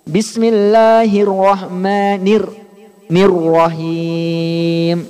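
drawn-out Quranic recitation, slow, chanted. Shown as a demonstration. Teacher chanting the full basmala as a model. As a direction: recite the basmala slowly and melodically. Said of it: "Silakan, Pak".

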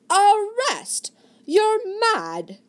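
high, loud furious protest; outraged shout. Acted. Sir Philip refusing arrest by Desmond, outraged shout. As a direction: hurl it back in outrage.

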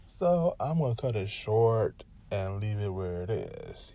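ordinary delivery

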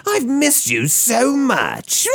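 very high, slow, laughing shout; Joker voice. Retold or imitated. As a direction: cackle it out like the Joker. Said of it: "Something like that".

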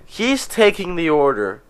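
ordinary talk, nothing marked

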